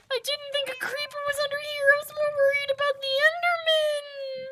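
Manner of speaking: normal talking voice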